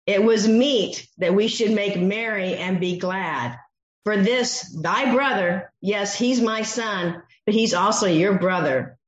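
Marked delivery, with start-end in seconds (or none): none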